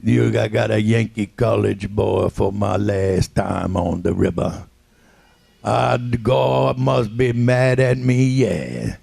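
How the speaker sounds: put-on a slow, grumbling Cajun accent for a college boy; old Cajun deckhand. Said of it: "he said".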